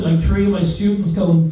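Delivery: normal talking voice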